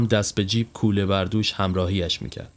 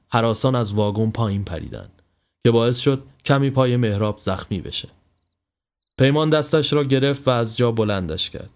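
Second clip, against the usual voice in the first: plain reading voice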